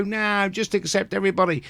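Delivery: hushed parody of a preachy celebrity; mocking celebrity quote. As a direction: sneer it out as the do-gooders. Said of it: "these people that just go".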